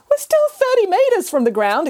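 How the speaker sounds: high, alarmed cat exclamation; exclaiming. Acted. Cat protesting to Nanny Piggins, high and alarmed. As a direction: blurt out the danger. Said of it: "exclaimed the cat".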